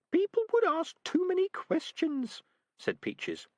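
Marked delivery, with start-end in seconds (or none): 0.1-2.4 much higher, small prim rat voice; a tiny rat scolding gently; a rat reproaching her friend, prim and high; prim little rat. Acted. Rat Peaches objecting.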